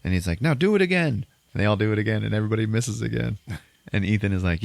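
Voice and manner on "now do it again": high-pitched mock-angry order; exasperated command, moderately raised in volume; imitated yelling. Gunslinger ordering bad shooters to retry, mock yell. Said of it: "he's fucking yelling at him".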